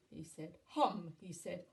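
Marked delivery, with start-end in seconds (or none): 0.6-1.1 loud, booming giant chant; one loud, falling giant call; hungry giant. A narrator's reading. Giant's chant turned jolly.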